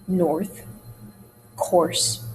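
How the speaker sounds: "north, coarse" with rounded OR, not widened; unwidened er sound. Shown as a demonstration. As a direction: keep the OR rounded in each word. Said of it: "as opposed to".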